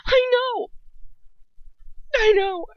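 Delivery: high, loud, desperate cry; desperate gasp. A narrator's reading. Hermione answering Ron about her parents, desperate gasp. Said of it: "I gasp in desperation".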